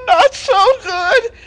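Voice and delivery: high, slow, whiny moan; milk the pity like a fake invalid; feigned sick lion. Lion fakes being sick.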